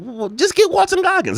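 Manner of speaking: very high-pitched protest; hammed-up high voice of complaint; exasperated mock outcry. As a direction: squeak out an exaggerated, fed-up plea. Acting out own annoyed reaction.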